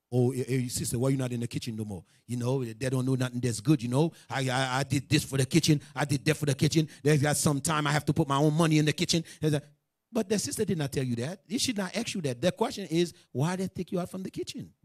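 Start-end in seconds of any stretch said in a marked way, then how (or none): 0.1-9.2 low mock self-justifying ramble; hesitant, low-pitched put-on grumble; imitated evasive complaint. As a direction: imitate someone hedging and making excuses. Imitating a sister dodging the question.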